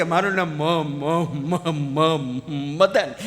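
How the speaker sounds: stuck repeating ma before the name; stutter. Retold or imitated. Mock stutter as the stranger gives his name. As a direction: stammer through it.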